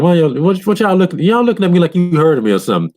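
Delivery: high, fast, alarmed asking; startled question. Retold or imitated. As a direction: rush it out high and suspicious. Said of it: "They're like".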